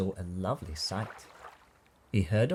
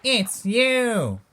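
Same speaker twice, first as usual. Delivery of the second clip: high, loud, long-held reveal; loud, high-spirited answer; merry exclamation; 13 semitones higher, 9 dB louder than usual. A narrator's reading. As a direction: call it out gleefully on a stretched last word. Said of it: "they laughed and said".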